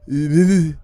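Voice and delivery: loud sputtering cranking noise; imitated old engine turning over and dying; engine failing to start. Explaining an old car that won't start, sputtering engine noise. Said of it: "the engine won't turn over".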